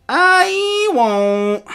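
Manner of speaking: very high, loud drawn-out wail; stubborn refusal. A narrator's reading. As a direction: stretch out the defiant refusal. Pinned boy refuses again.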